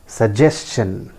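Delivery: Suggestion slowly, as su-JES-chun; correct pronunciation. Shown as a demonstration. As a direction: clear S plus CH in Suggestion. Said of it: "the correct pronunciation is". (Suggestion का सही उच्चारण, स-जेस-चन)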